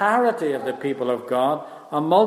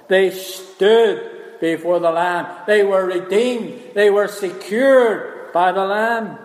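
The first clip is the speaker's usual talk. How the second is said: slow, raised, drawn-out declaration; emphatic preaching; 4 semitones higher, 4 dB louder, slower than usual. Acted. Preacher proclaiming the redeemed to the congregation, emphatic. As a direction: proclaim it with weight on each key word.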